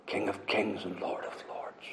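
hushed, unvoiced whisper of the title. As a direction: breathe the words out in reverent awe.

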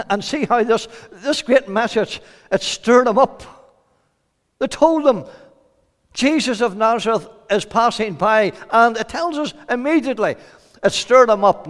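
high, forceful sermon delivery; fervent preaching. Real, not acted. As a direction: preach it out with urgency.

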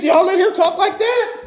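high, indignant cry; David's high outraged challenge. Retold or imitated. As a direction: call them out in disbelief. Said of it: "David said".

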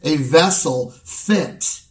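steady pulpit preaching to the congregation. Acted. Pastor charging the church at an elder's ordination, in steady preaching voice. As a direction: deliver it as a sermon from the pulpit.

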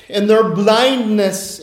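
ordinary talk, nothing marked

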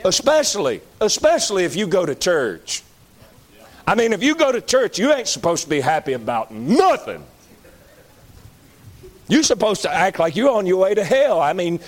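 usual speaking voice

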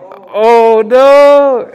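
high, loud dismay in the retelling; shocked oh no. Retold or imitated. Uncle realizing the flan was his sister-in-law's, high and loud. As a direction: gasp it out, caught red-handed. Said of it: "I'm like".

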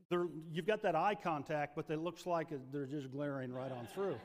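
ordinary talk, nothing marked

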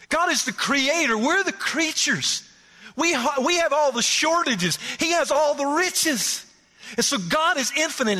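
high, ringing preacher's proclamation; full-force preaching. Acted. Preacher proclaiming God's riches to the church. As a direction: lift it up and proclaim it.

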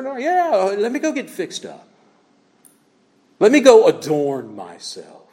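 a hint of a primping lady; mock lady going to primp. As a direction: play the woman off to get fixed up. Mimics woman going to primp.

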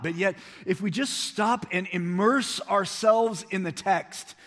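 high, loud, driving preacher voice; full-force preaching. Acted. Pastor rousing his congregation, loud and high. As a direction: preach it out to the whole room.

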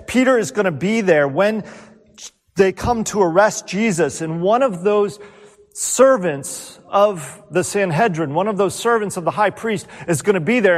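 usual speaking voice

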